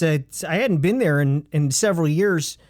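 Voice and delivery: usual speaking voice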